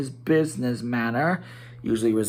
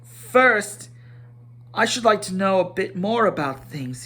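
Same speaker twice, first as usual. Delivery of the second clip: nervous but determined; 7 semitones higher, 3 dB louder than usual. A narrator's reading. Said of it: "feeling all confused and a bit shaky inside".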